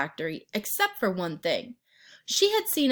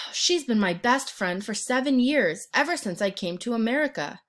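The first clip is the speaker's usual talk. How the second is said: ordinary delivery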